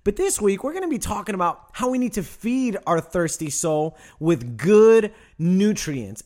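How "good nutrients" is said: "good" drawn out long and loud; stress on good. Real, not acted. Preacher tells congregation what to feed the soul, "good" heavily emphasized. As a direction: lean hard on "good", slowly. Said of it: "Emphasis on the word good".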